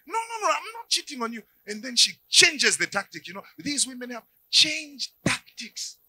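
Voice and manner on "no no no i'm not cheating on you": higher, pleading no, no, no; insistent denial. Acted. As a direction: deny it again, pitched up. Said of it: "And he says".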